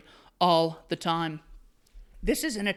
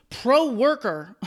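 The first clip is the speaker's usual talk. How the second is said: mocking drawl; 4 semitones higher, 3 dB louder, much slower than usual. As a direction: drag out Pro-worker slowly, higher and louder. Mocking the article's phrase.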